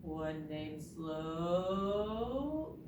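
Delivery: very slow and drawn out; dragging, sluggish delivery; real slow. Shown as a demonstration. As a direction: stretch SLOW right out. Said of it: "Can you say it real slow?".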